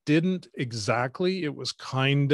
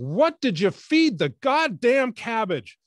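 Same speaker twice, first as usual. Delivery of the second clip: high, loud, slow, furious at the end of her rope; exasperated shout; 11 semitones higher, 4 dB louder, slower than usual. Retold or imitated. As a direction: spit out every word, out of patience. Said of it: "culminating in her going".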